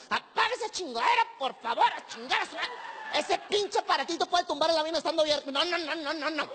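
high, shrill-edged mock stewardess scolding; angry flight attendant. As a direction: play the fed-up flight attendant barking orders. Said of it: "la sobrecargo te ve y te, ay, se encabrona".

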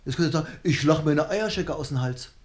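ordinary talk

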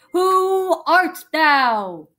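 slow, stern, loud demand; angry. Acted. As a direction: drag it out, threatening. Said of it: "he asked angrily".

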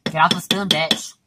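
half-yelled order at a stubborn thing; mild yell. Real, not acted. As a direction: scold it with a small burst of volume. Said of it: "I just yelled at bitch".

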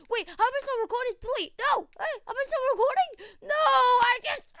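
high, fast panicked yelps; panicked shouting. Acted. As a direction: blurt it out in a sudden panic. Caught still recording, panics.

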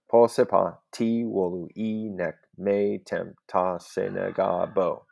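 slow, measured beat on "Po sepa, ti wolu e nek me tem ta se nega bo"; metrical rhythm. Shown as a demonstration. Teacher reading the scanned line to show its rhythm, metrical rhythm. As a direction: sound out "Po sepa, ti wolu e nek me tem ta se nega bo" foot by foot, long syllables held. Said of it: "So there's that rhythm".

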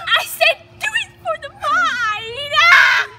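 high, slow, drawn-out shout; slow triumphant yell. Real, not acted. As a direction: bellow it out like a battle cry. Yelling while going under.

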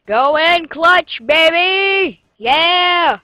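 loud, drawn-out hype yell; excited shout. Real, not acted. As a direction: cheer it like a big win. Cheering a clutch play.

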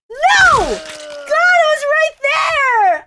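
anguished yell, dragged out and loud; real despair shout; frustrated shout. Real, not acted. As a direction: howl at the near miss. Losing right at the goal.

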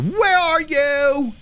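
slow, high singsong holler; high drawn-out call. Pretend. Player calling to the ghost, high drawn-out call. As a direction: stretch the question out high.

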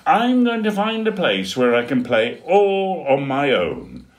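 bright determined penguin chick; small penguin setting off alone; penguin Dudley. Acted.

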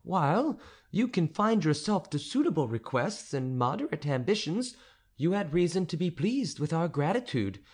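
stately countess scolding a presumptuous suitor; haughty countess. Acted. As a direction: put the upstart tutor firmly in his place. Countess rebukes the tutor.

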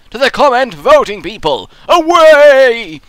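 "Away!" shouted high and loud like a herald; grand proclamation. Acted. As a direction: proclaim it like sending troops off.

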